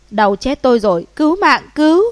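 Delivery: loud cries for help, climbing high; screaming in pain. A narrator's reading. Thug with broken arm calls for rescue, pained scream. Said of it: "ôm cánh tay đau đớn mà thét lên".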